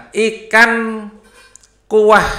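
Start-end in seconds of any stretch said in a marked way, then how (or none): none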